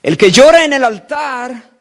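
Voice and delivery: preaching shout. Real, not acted. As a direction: hammer the line home. Preacher repeats his denunciation. (grito de predicador, más fuerte y agudo)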